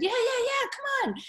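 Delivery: eager nurse. Retold or imitated. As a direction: bounce it up high like an eager friend waving you in. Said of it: "And she said".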